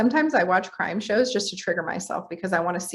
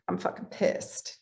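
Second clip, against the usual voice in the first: softer, half-whispered mock gut reaction; mock anger under the breath; 8 dB quieter than usual. Voicing automatic gut anger.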